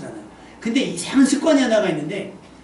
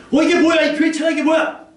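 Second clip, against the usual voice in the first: loud, high, startled outburst; shocked exclamation; 9 semitones higher, 6 dB louder than usual. Acted. Churchgoer spotting the scratched church car, shocked exclamation. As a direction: act out discovering damage with a shock.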